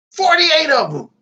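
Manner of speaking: esclamazione incredula, acuta e forte. Real, not acted. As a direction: sbotta alzando voce e tono per lo sbalordimento. Incredulous at the oyster count.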